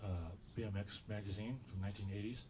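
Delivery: normal talking voice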